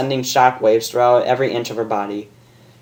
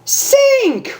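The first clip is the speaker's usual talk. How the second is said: very high joyful shout; excited yell; 4 dB louder than usual. A narrator's reading. Rainbow spotting her lover, high joyful yell. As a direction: cry out to a lover. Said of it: "Rainbow yelled".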